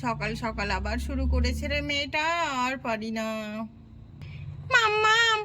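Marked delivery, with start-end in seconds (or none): 4.7-5.4 put-on high, bright child voice; a little girl showing off her work; high child calling her mum; proud little girl.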